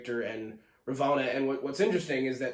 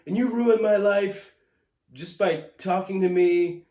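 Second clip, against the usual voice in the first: ruined pushed high and loud in mock accusation; hammed-up accusing Sylvie, louder; 6 semitones higher, 7 dB louder than usual. Reviewer mocking Sylvie's accusation, high and loud. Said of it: "She's like".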